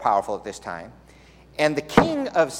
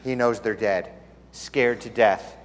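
ordinary talk, nothing marked

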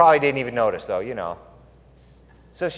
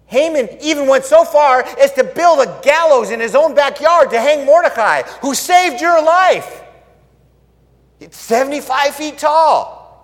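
urgent report; 10 semitones higher, 9 dB louder than usual. Acted. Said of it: "one of them said".

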